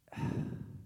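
long breathy sigh of letdown; disappointed sigh. Acted. Daughter looking at her presents on Christmas morning, disappointed sigh. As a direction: let out a heavy, acted sigh.

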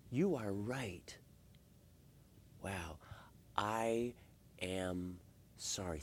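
hushed, slow and low, word by word; humble admission. Acted. Husband giving his wife the magical words, humbly. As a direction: let each word land softly and sincerely.

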